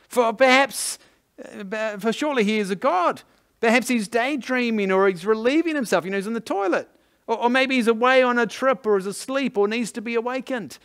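high-pitched gleeful trash talk; mocking. Elijah sledging the prophets about their absent god, mocking. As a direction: taunt them with mock-helpful suggestions. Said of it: "Elijah began mocking them".

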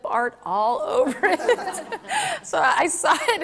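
laughing through the words, slow and high. Real, not acted. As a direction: laugh at the memory as you say it. Laughs recalling clip art.

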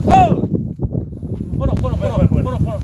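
loud, urgent corner calls; urgent shout. Real, not acted. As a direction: yell them back to the corner. Referee stops the fight urgently.

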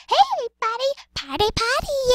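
very high, loud squealing host voice; hammed-up squeal of party excitement; Cookie Swirl Sweet. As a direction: greet fans in a loud put-on squeak. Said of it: "I sound like Cookie Swirl Sweet".

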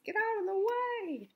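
high, put-on pushy truck voice; rude dump truck. A narrator's reading. Dump truck clearing the road, rude high call. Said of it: "being a little bit rude".

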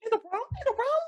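very high squeaky voice; falsetto. Real, not acted. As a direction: pipe it up into falsetto, eager to convince. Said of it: "that falsetto was very real".

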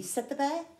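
ordinary delivery, nothing marked

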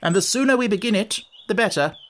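imperious challenge. A narrator's reading. Red Queen challenging Alice's right to the title, imperious. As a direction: lay down the rules imperiously.

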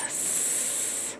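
hissed S; "S-" as a long unvoiced hiss; just the sss, no voice; bare s sound. Shown as a demonstration. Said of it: "Capital S".

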